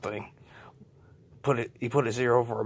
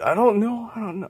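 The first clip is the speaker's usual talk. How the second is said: helpless crowd; 10 semitones higher, 8 dB louder than usual. Acted. Everybody answering helplessly.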